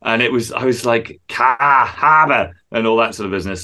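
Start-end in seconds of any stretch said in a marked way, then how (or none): 1.3-2.5 slow, drawn-out Boston accent imitation. Mimicking the Boston accent for a friend, slow Boston drawl. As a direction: stretch it out like a broad Boston local. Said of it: "doing a pretty good Boston accent".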